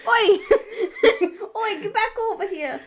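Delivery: mock Brooklyn tough-guy call; hammed-up Brooklyn accent; shitty Brooklyn accent. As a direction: bark it like a bad New York cabbie, Brooklyn. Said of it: "shitty Brooklyn accent".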